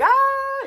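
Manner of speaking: very high, loud yelp imitating a victim; mock scream. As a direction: squeal like the beaten guy. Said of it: "screaming like a little bitch".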